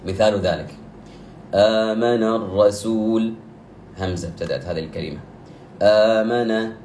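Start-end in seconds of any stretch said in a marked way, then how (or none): 1.5-3.3 آمن with its opening long a drawn out for two beats; slow recitation. Shown as a demonstration. Teacher giving the badal example, slow recitation. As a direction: chant آمن الرسول slowly, holding the long a. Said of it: "قبل حرف المد يكون عندي همزة".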